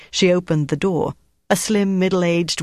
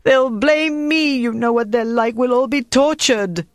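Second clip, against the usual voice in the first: frightened moaning; 8 semitones higher, 3 dB louder than usual. A narrator's reading. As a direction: moan it out in dread of the torture. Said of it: "Antoinette began to moan and rock".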